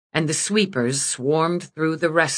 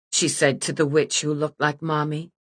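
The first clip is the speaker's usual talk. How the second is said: ordinary delivery, nothing marked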